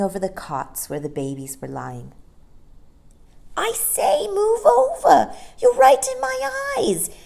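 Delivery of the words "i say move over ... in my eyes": put-on high, loud complaining baby. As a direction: whine it loudly like a baby squinting at the sun. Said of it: "said John in a loud voice".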